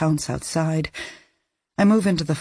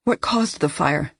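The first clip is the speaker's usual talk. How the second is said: rough, hoarse voice like unused for years. Acted. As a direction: croak it out hoarsely. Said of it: "I sound hoarse".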